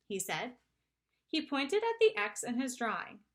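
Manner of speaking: ordinary delivery, nothing marked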